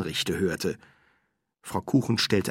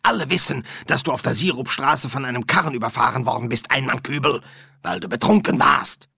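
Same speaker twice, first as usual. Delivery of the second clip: louder, higher, quick telling-off; brisk, raised-voice reproach; scolding medium; 5 semitones higher, 5 dB louder, faster than usual. Acted.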